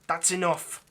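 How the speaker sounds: firm command. Acted. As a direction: shut the protest down hard.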